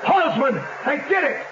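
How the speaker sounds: high, stricken cry of disbelief; anguished outcry. Acted. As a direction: cry out as the horse goes down. Owner sees horse fall in race.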